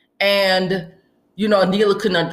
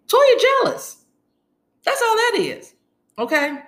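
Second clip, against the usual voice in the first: much higher, sassy final verdict; sassy high squeal. Real, not acted. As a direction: squeal it out with attitude.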